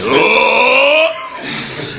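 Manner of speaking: high power-up yell; power up scream. Retold or imitated. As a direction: belt a cheesy power-up cry. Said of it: "the Colossus power-up yell".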